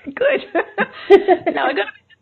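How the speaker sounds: bursts out laughing; high, loud laugh. Real, not acted. Laughing after being told notes written.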